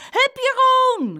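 high, loud, drawn-out cheer; girl yelling from the sidelines; cheering shout. A narrator's reading. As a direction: cheer on your brother in a fight. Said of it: "riep Saskia".

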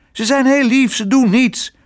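hoog, luider verbaasd uitgeroepen; verbaasd roepen. Acted. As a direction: roep het verbaasd uit. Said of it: "riep Otje verbaasd". (Otje verdedigt haar muizen: verbaasd roepen)

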